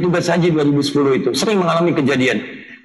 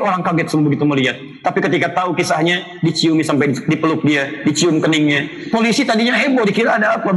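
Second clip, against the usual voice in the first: ordinary talk, nothing marked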